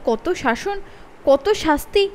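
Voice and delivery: ordinary delivery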